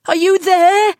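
high, loud yell; loud, high call for someone gone. A narrator's reading. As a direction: shout into the empty room. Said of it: "I yelled".

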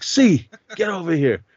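high mock call across the street; imitated summons. Sonny summoning the kid over, imitated summons.

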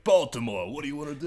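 mock Brooklyn tough talk; bad Baltimore impression that comes out Brooklyn; Brooklyn accent. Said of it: "gonna have a Brooklyn accent".

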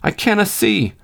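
put-on high-pitched sulky boy's whine; high, put-on boyish sulk; complaining, sulky. A narrator's reading. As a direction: complain sulkily in a child's high voice. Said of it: "the lad repeated complainingly, sulkily".

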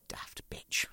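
unvoiced whisper under the breath; whispered insult; whispered aside. Real, not acted. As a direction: hiss it as a secret aside.

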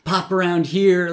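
mock his suspicious sneer; angry sarcasm. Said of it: "he's angry".

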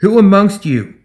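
clear, projected public speech; high and clear. A narrator's reading. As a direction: address a whole crowd with firm conviction. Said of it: "Her voice rang out across the square, high and clear".

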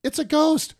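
high-pitched imitation of panic; fearful cry. Terrified disciples cry out at Jesus, high fearful cry. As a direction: squeal it like a frightened disciple. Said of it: "they cried out".

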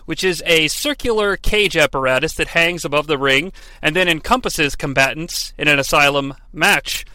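usual speaking voice

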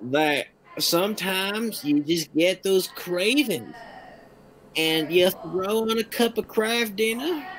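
hammed-up Southern accent, lilting up at phrase ends; best Southern accent. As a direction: play a Southern home cook talking up a recipe. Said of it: "in my best Southern accent".

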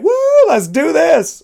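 high, loud cheer; excited whoop. Retold or imitated. Dreaming of a fun partner, a high excited whoop. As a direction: whoop it up like a thrill-seeker. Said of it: "somebody that's like".

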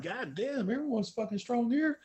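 quiet high awed mutter. Retold or imitated. Lifter in the warm-up room, quiet awed mutter at the strong crowd. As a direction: mutter it under your breath, impressed. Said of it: "I'm like".

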